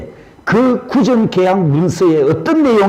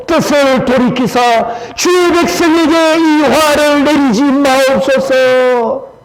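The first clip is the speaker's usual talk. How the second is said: high, loud impassioned scripture reading; fervent reading; 8 semitones higher, 3 dB louder than usual. Acted. Preacher leading congregation reading Moses' plea, impassioned and high. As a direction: cry the prayer out with fervor. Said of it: "다 같이 읽습니다".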